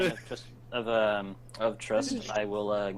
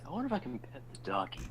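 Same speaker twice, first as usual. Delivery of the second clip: soft inner voice; 3 semitones higher, 9 dB quieter, faster than usual. Retold or imitated. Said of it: "just like, in your head".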